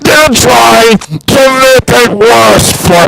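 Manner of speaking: high, loud, strained ranting voice; awkward voice. Acted. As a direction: rant in a squeaky awkward put-on voice. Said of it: "I did it in a special awkward voice".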